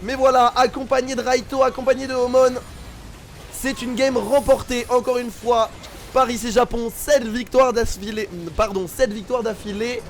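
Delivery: loud, slow victory call; esports caster. Real, not acted. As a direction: announce the win to the crowd. Caster celebrates the win.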